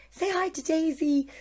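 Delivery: high singsong baby-talk imitation; mock doting-mum voice; mother to baby. Mother talking to her baby.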